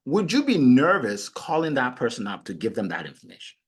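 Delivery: ordinary delivery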